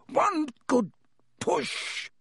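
straining. Acted. Fred straining to pull trunk.

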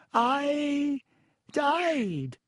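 put-on high, whiny ghost girl voice. A narrator's reading. Myrtle dramatic reveal.

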